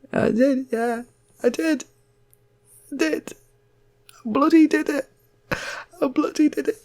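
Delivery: high-pitched boastful lad; squeaky proud son. Retold or imitated. Son telling his da, high and proud. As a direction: squeak out the triumph. Son answers dad in story.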